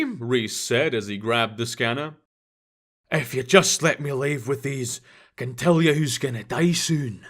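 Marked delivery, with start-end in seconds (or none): 3.1-7.2 put-on grumbling old man in a thick Scottish accent. A narrator's reading. Sweeney grumbling to the shopkeeper, thick Scottish accent. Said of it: "Sweeney grumbled in his thick Scottish accent".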